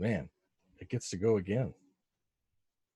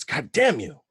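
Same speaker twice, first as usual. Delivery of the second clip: slightly raised, higher in-character curse; mild narrated scream; 5 semitones higher, 4 dB louder, slower than usual. A narrator's reading. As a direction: give a contained acted yell with punch. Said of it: "And she screams".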